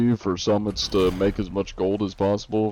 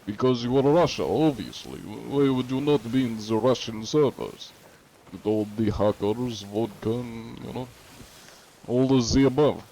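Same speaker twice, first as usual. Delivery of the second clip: mock Russian accent; 3 semitones higher than usual. Streamer joking to chat about where he's from, in a mock Russian accent. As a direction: play the big sweaty Russian man with a Russian accent. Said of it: "And then people are like".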